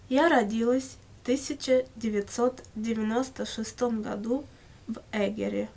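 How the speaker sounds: ordinary talk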